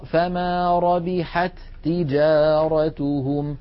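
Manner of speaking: ربحت تجارتهم said rabiḥattijāratuhum, first ت not sounded; slow, deliberate demo; merged reading. Shown as a demonstration. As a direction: stretch it out, merge the two ت. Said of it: "لا نظهر التاء الأولى".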